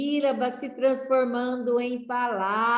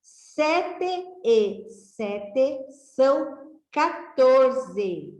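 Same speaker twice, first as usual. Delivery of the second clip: slow reading aloud; 3 semitones higher, much slower than usual. Shown as a demonstration. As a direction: read the rhyme out slowly, syllable by syllable. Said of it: "Vamos ler?".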